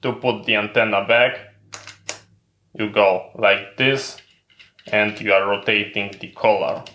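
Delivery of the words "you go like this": ordinary delivery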